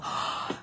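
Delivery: soft, voiceless gasp of recognition; hushed nostalgic gasp; acted gasp. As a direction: breathe in with sudden wonder. Acted reaction to a remembered scent.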